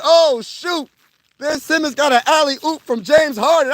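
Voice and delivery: thrilled reaction to a highlight; excited. Retold or imitated. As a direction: hype it up like you can't believe it. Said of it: "I said".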